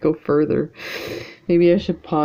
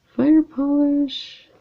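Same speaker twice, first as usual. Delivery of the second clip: high, drawn-out mock yell; imitated viewer yelling; 13 semitones higher, 6 dB louder, slower than usual. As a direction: call it out like exasperated viewers, imitating them. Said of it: "yelling at me going".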